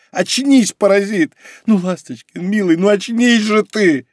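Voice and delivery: high, urgent begging to wake up; scold, then beg, drawing out the end; frantic high-pitched plea; desperate pleading. A narrator's reading. Desperately rousing an unconscious companion.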